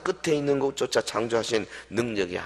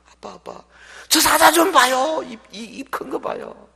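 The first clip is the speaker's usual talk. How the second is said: put-on high, frightened little boy's voice; scared child; 12 semitones higher, 10 dB louder than usual. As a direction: play the three-year-old pointing at the lion. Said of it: "아빠가 물으니까". (겁먹은 세 살 아이 목소리)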